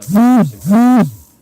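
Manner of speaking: very high, very loud shout; sudden yell. Real, not acted. Bragging about University of Texas, sudden loud yell. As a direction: blurt it out at full volume.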